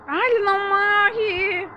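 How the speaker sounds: high, slow wailing disbelief; drawn-out whine of despair; stretched-out dismayed whine. Real, not acted. Monster won't die.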